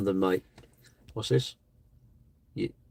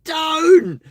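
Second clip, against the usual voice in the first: sudden very high, loud yelp; a bitten-back yell bursting out; shouted don't; 9 dB louder than usual. Retold or imitated. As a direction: blurt it out as a sharp, loud high cry. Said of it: "bite my tongue and go".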